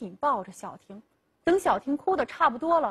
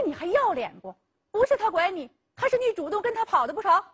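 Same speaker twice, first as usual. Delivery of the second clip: an angry father's raised, fast scolding; 7 semitones higher, faster than usual. Acted. Father scolds daughter for running off, angry and fast. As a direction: scold her hard and quick, pitch climbing. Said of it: "黄老汉气的是一个巴掌就扇了过来".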